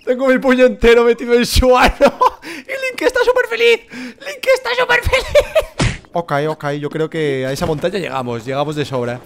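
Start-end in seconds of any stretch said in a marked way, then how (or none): 0.0-2.2 high, loud and amused; gleeful crude boast. Real, not acted. Gamer joking about Link, gleeful loud voice. 2.5-6.1 very high squeal through laughter; hysterical laughing. Real, not acted. Gamer joking that Link is happy, laughing hysterically. Cracking up at own joke.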